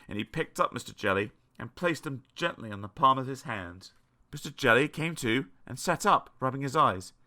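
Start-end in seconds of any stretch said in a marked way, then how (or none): none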